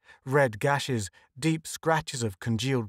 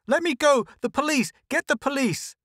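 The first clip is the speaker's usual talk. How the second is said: frantic yell; 13 semitones higher than usual. A narrator's reading. Lois struggling past grabbing hands, high frantic yell. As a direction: yell to break free.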